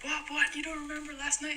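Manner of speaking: high-pitched mock Russian disbelief; baffled Russian guys. Russians questioning the girl pretending not to know them, high incredulous imitation. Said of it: "And they're like".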